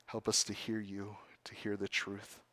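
whispering the plea; whispered prayer. Real, not acted. Pastor whispering a plea to God in prayer. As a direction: breathe the request to God.

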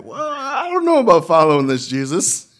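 high, loud mock whine of a reluctant follower; doubting believer. Preacher imitating a doubter talking to Jesus, mock reluctant whine. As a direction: play the wary believer backing out, laughing at the end. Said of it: "you're just like".

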